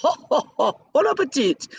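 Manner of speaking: high, slow, sing-song TV chef voice; Julia Child impression. Fan imitates Julia Child signing off her cooking show, high and slow. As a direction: savor it like a TV cooking host. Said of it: "bringing out the Julia in me".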